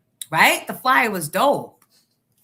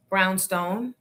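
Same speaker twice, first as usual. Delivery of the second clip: ordinary delivery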